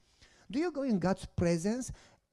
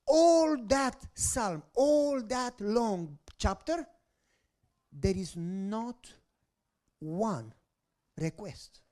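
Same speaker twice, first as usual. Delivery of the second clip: slow emphasis; much slower than usual. Real, not acted. Emphatic slow preaching point.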